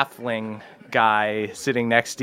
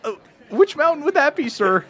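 put-on higher, stammering nervous young voice; nervous halfling boy; 11 semitones higher than usual. Halfling scoutmaster pleading with a hero, high and jittery. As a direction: blurt it out like a scared scout leader.

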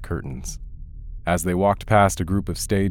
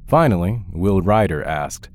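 usual speaking voice

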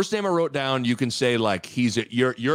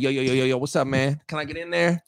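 guy talking his way in; faster than usual. Pretend. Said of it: "you're the bouncer. I walk up. I'm like".

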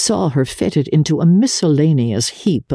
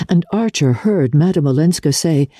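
plain reading voice

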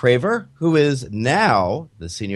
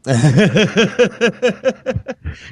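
big laugh; 11 semitones higher, 5 dB louder than usual. Real, not acted. Laughing at Fargo joke.

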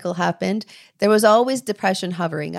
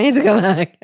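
louder, laughing through the repeat; louder second take with a laugh breaking in; 5 dB louder than usual. Retold or imitated. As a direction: laugh at your own urgency.

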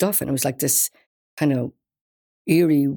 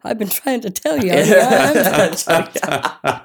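chuckling as she retells his insistence; laughing through it; 4 semitones higher, 4 dB louder, slower than usual. Retold or imitated. Retelling [name]'s reply while laughing. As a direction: quote breaks into laughter, slow at the end.

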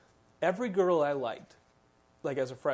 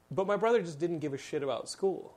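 usual speaking voice

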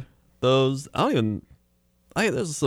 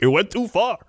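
higher punched Chicago ad voice; Chicago guy; 11 semitones higher than usual. Retold or imitated. Comic mimicking a radio commercial guy, high Chicago accent. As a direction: deliver the catchphrase in mock Chicago. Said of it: "I say it with him".

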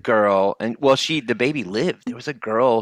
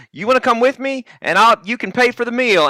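high-pitched, eager invitation; high, slightly louder character voice; Ernest; 11 semitones higher, 4 dB louder than usual. Retold or imitated. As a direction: imitate a chummy goofball, pitched way up. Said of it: "He's like".

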